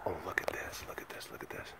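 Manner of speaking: hushed whisper. Real, not acted. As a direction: whisper it so she won't hear. Whispering while watching animal.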